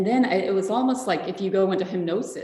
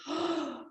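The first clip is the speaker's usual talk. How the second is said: a high, soft retold gasp of awe; reliving a breathless moment of wonder; sharp gasp; 8 semitones higher, 6 dB quieter than usual. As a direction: catch the breath as if overwhelmed. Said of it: "I could almost barely breathe".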